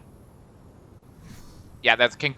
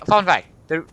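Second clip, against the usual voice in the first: ordinary delivery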